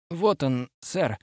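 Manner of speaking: understated, halting nervous reply; speaking with difficulty. A narrator's reading. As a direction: get the words out with some effort. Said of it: "с трудом произнёс Бурман".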